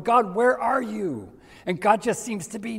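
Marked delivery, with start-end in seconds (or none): 0.0-1.3 a higher, slowed "God, where are you?"; frantic plea. Retold or imitated. A busy believer on the treadmill calling to God, higher plea. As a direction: cry out to God, lost and worn out. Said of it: "you're going".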